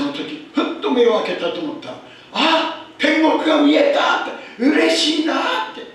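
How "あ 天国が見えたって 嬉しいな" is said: put-on higher, louder joyful old mother. Pastor retelling his dying mother's words, joyful. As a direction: lightly voice a mother who sees heaven.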